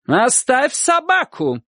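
high, drawn-out shouted order; shouting. A narrator's reading. Aunt to dog-catcher from the landing, shouting. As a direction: cry it out high and slow. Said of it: "кричала она".

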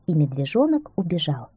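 ordinary delivery

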